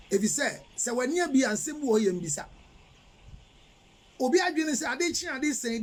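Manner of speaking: ordinary delivery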